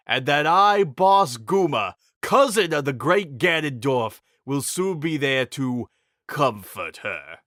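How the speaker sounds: mock the dying king; villain boasting of himself; gloating brute. A narrator's reading. Boss Guma taunts dying king.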